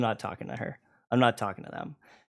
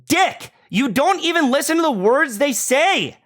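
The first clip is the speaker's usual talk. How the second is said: high, loud heated rant; angry shouting; 10 semitones higher, 4 dB louder than usual. Real, not acted. Guest ranting loudly at the streamer for not listening, angry. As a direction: lay into him, voice raised. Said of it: "Yes, I'm getting angry".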